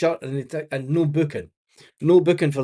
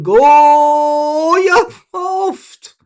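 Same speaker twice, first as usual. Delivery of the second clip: much higher and louder yell; shouting at the telly; 11 semitones higher, 10 dB louder, much slower than usual. Real, not acted. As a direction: bellow it at the players on screen. Shouts at the match on screen.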